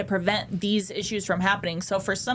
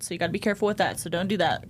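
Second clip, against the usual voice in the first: ordinary delivery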